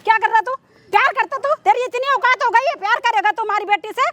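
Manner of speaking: outraged mother's tirade; scornful, indignant scolding; angry scolding. Acted. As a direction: berate him with indignant questions. Acted mother berates servant lover.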